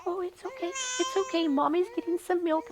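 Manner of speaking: put-on high, sing-song hush for a fussing baby; soothing a baby. Girl soothing the fussing baby while mommy fetches milk. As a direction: comfort the little one gently.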